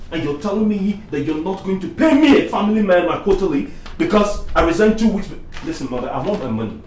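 rising from heated to furious yell; angry building to shout. Acted. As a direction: build until you explode at Mother. Son confronts mother over pay.

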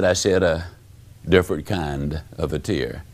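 hushed-down pitch, grave and deliberate; solemn, moved. Retold or imitated. Retelling a tearful, life-changing realization.

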